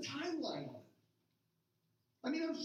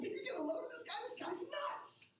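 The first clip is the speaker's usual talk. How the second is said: much higher, scornful mock disbelief; mocking jeer; 10 semitones higher than usual. Bystanders jeering at Jesus in high mock disbelief. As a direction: squeak out the ridicule like a heckler.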